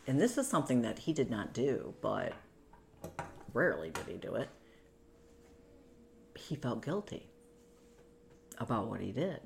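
normal talking voice

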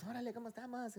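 high, jovial imitated hello; hearty greeting. Recalling don Emilio arriving at the office, a high, jovial greeting.

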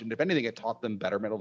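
ordinary delivery

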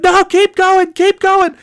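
very high, strained urging; imitated yelling. Trucker urging novice driver on, imitated yelling.

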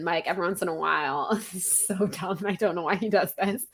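ordinary talk

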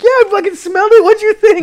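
very high, loud indignant yell; retold pissed-off outburst. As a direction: throw it back at her, high and loud. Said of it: "I'm like, I'm pissed".

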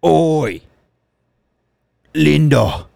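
put-on warming up a Birmingham gangster accent; Arthur Shelby impression. Co-host warms up his Arthur impression, Birmingham accent. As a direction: call out Oi like a Peaky Blinders thug. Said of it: "I'll hit the Arthur".

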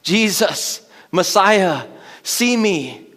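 a mock cry of a blind beggar, pitched high. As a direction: call out to Jesus for help. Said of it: "a constant cry".

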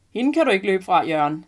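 loud, hearty teasing of a friend. A narrator's reading. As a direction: boom it out jovially. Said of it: "siger manden med en høj stemme".

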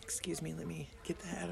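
soft whispered excuse. Real, not acted. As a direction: whisper it while clearing throat. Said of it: "clears throat".

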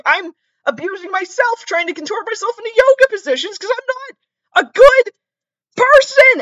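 very high, loud hammed-up wail; exasperated meltdown. Caller defending himself to animal control, screeching meltdown. Acted outburst back at yelling lady.

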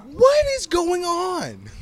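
high, slow, stretched-out outrage; exasperated disbelief. Real, not acted. Co-host reacting to Elf on the Shelf with high, slow disbelief. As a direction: drag it out in total disbelief.

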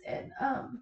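fighting back tears, quiet; crying. Real, not acted. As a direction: voice breaking into tears. Crying while telling miscarriage.

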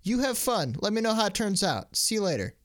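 high, light airy voice tossing it off; bow out with a breezy high lilt; breezy brush-off. Retold or imitated. Character waving off a Discord argument, airy and high. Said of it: "he'd have been like".

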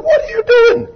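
very high, pleading squeal; woman voiced in a high confused cry; bewildered woman. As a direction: squeak it out in alarm. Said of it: "She said".

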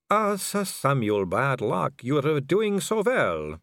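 high, slow, silky vampire voice; a vampire noblewoman purring at a dangling man; suave vampire lady. Acted. Purring vampire lady taunting Vimes, slow and high.

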